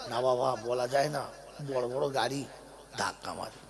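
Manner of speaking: low, hushed reply of a wary uncle; cautious old villager. Acted. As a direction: answer softly and low, dropping away at the end. Villager answers why he avoids roads.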